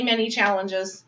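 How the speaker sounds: ordinary delivery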